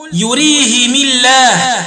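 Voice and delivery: "يريهم الله" as yurihimi-llah, light l; slow link, i after both h and m in "يريهم"; kasr ha and mim. Shown as a demonstration. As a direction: recite "يريهم الله" with -himi and a thin l. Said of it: "بكسر الهاء والميم وصلا".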